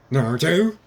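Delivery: loud, high, rising question; surprised recognition. Acted. Old man spots Naruto walking into the ramen shop, surprised. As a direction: call the name out in surprise.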